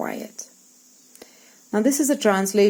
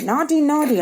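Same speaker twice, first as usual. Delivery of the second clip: scolding brother; 7 semitones higher, 3 dB louder than usual. Acted. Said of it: "I get angry with her and I say".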